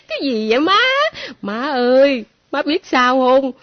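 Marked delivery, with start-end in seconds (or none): none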